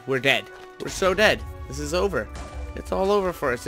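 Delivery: ordinary delivery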